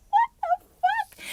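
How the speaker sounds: laughing disbelief. Real, not acted.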